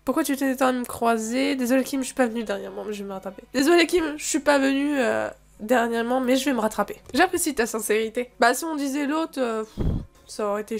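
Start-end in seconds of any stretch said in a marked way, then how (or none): 3.5-7.0 acted apology. Player apologising to Kim for not coming lately, apologetic.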